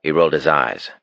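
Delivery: ordinary delivery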